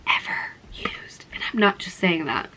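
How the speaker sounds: hushed, breathy whisper for emphasis; whispered aside. Real, not acted. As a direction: whisper it like a secret. Confiding over favourite mascara.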